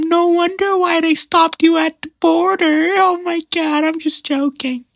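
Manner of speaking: very high, slow, gushing auntie voice; auntie impression. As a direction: gush like the auntie pinching his cheeks. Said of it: "I could do a perfect impersonation".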